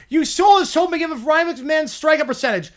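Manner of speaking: loud, high heckle from the stands; fan shouting. Acted. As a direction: yell it from the gallery. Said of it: "shouts from the gallery".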